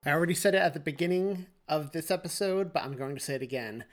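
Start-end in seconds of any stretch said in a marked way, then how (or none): none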